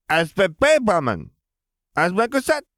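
put-on high, eager little boy; toddler voice. A narrator's reading. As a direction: insist like a small child. Ben explains.

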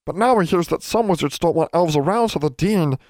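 high little elf voice, matter-of-fact; a chirpy servant elf with odd grammar; house elf. Acted. Poe the elf telling Alexandra his view, high elf voice.

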